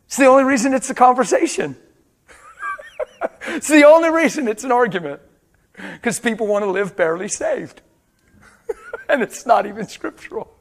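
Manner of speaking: high, loud, laughing through the words. Real, not acted. Pastor laughing at the church's argument, high and loud. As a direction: laugh in disbelief as you preach it. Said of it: "I'm laughing or I'll cry".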